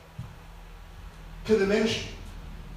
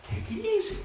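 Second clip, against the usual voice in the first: gentle reassurance; 11 semitones higher, 9 dB quieter than usual. Acted. Pastor voicing soothing reassurance.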